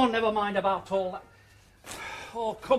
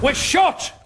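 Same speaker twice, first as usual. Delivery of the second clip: calling out; 11 semitones higher, 12 dB louder than usual. Acted. Shopkeeper calls out to shop.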